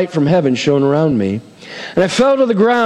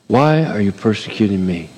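gentle voice of Jesus; 7 semitones lower than usual. Acted. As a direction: speak low, like a patient Lord. Jesus speaking to Paul.

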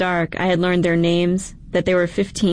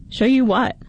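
surprised; 6 semitones higher than usual. A narrator's reading. Donnie surprised.

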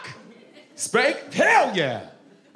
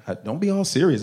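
ordinary talk

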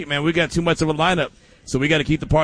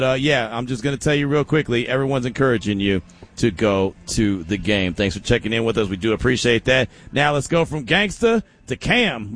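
normal talking voice

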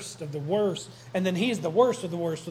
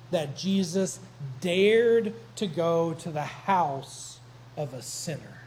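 ordinary talk

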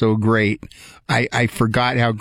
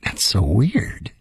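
whisper; 2 semitones lower, much slower than usual. Real, not acted. Eerie reaction to memory glitch.

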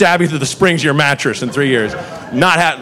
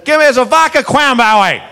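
higher, put-on voice of a drunk customer ordering; 7 semitones higher than usual. Customer orders a drink from bartender, mock drunk voice. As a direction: ask for the drink like a tipsy regular. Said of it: "So he says".

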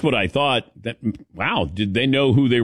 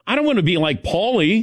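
higher teasing jab at Paulie; retold retort; 8 semitones higher than usual. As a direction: tease him, pitched up. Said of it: "I said".

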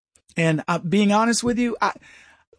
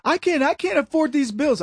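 higher, pleading mock voice; mock plea; 7 semitones higher than usual. Host mimics a politician begging a donor, higher and pleading. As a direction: whine it like a desperate beggar.

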